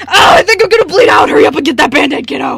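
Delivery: high, loud mock wail; loud fake crying for help; hammed-up panic shout. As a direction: ham up bleeding to death. Character fakes bleeding out.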